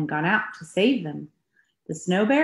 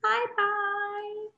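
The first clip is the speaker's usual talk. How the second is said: much higher, softer little goodbye; tiny baby bear voice; 5 dB quieter than usual. Shown as a demonstration. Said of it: "how about a baby bear".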